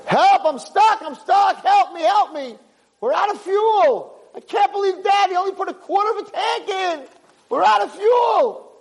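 high, loud desperate cries for help; stranded brother yelling in panic; panicked screaming. Acted. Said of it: "his brother's screaming".

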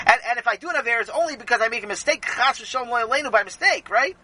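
put-on high, rushed boy's pleading; Sammy, anxiously hedging. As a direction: hurry through the excuse, seeking agreement. Sammy pleading his case.

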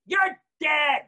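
high, loud burst; sudden shout. Real, not acted. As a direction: jolt them awake. Sudden punchline.

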